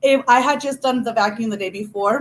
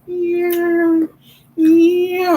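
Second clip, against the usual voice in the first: high, drawn-out motor whine; vacuum noise; 8 semitones higher, 4 dB louder than usual. Retold or imitated. Child pushing vacuum under the bed, imitated motor whine. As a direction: imitate a vacuum revving in long high tones. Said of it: "start vacuuming under the day bed".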